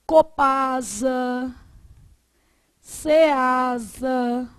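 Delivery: COPASA and CEASA said slowly as whole words; syllable-formed acronyms. Shown as a demonstration. Teacher showing acronyms that form syllables, slow word-by-word demonstration. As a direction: draw out "copasa" and "ceasa" as spoken words, not letters. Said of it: "ou seja, forma sílaba".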